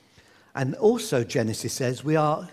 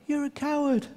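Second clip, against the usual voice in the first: higher but softer repeat; echo; 8 semitones higher, 5 dB quieter than usual. Retold or imitated. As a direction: bounce back the insult faintly. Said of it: "you could hear an echo".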